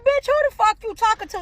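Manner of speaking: high, loud challenge; confrontational shout. Retold or imitated. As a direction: square up and call her out. Confronting woman at club.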